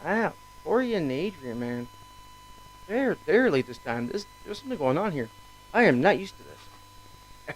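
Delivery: ordinary talk, nothing marked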